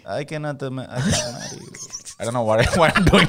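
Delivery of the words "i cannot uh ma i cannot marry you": put-on soft, halting Russian accent; botched Spanish accent drifting into Russian; accidental Russian accent. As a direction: decline hesitantly in a muddled Russian accent. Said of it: "like, a Russian accent".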